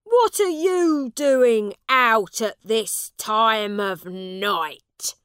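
a big angry man's loud, rough shout. Acted. Angry man shouts at the lost boy, loud. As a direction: bark at the boy in the street. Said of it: "shouted the man".

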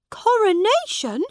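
high, loud startled question; surprised. A narrator's reading. Prince hearing of tomorrow's coronation, surprised. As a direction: catch the word in shock. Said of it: "said Edward, surprised".

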